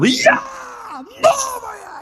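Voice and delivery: very high, shouted «Я новая». Retold or imitated. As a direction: yell it out at the top of his voice. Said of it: "Потому что я орал".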